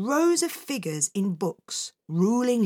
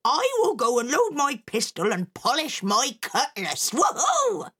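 excited pirate mother; 6 semitones higher than usual. Acted. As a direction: whoop with glee at the end.

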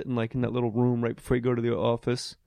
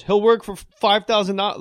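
eager agent pitch; 8 semitones higher, 6 dB louder than usual. Retold or imitated. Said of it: "your agent picks up the phone and goes".

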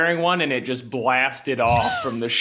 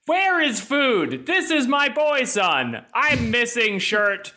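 high, slow, vacant Goku voice; dim Goku; 9 semitones higher, slower than usual. Retold or imitated. Mocking Goku's odd first words after the time chamber, high and slow. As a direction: play Goku as a spaced-out simpleton, high and slow. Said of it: "the order of sentences Goku chooses".

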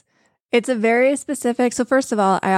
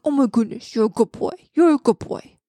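dog voice; 3 semitones higher than usual. Shown as a demonstration. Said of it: "With dogs, I'm like".

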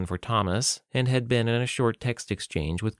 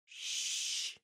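sharp breathy shush; hissed shush; 14 dB quieter than usual. A narrator's reading. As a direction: hush someone with a quick hiss. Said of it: "the librarian assistant hissed".

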